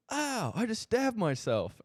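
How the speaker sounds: high, mild mock yelp of surprise; imitating a hurt friend. Friend who cut himself with a knife, high mock yelp. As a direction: squeak it out like a surprised buddy. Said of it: "and he goes".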